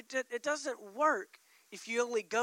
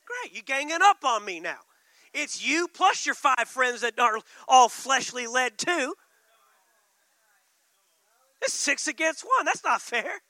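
mock-whiny Holy Spirit; 8 dB louder than usual. Holy Spirit complaining about the gang-up, mock whine. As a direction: sulk that it's unfair. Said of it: "the Holy Spirit's like".

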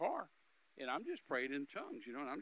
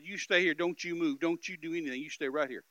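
stern warning; 2 semitones higher, 8 dB louder than usual. Retold or imitated. Robber warns him.

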